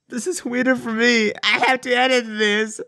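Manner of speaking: high-pitched incredulous whine; amused high complaint; exasperated protest. Real, not acted. Protests about editing vomit sounds.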